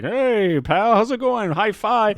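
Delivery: high, loud, hammed-up jokey hero; mock a chummy action-movie wisecracker; Chris Pratt. Action hero joking with a pal, mock chummy cheer. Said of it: "like, Chris Pratt".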